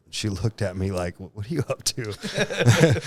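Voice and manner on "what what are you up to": slow, higher suspicious wife; mock suspicion. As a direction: imitate a wary wife, slowly, with amusement. Wife's suspicious look voiced, with laugh.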